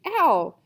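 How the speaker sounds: loud, high "ow"; yelp of pain. Acted. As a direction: cry out as if struck. Cry as his head is smashed.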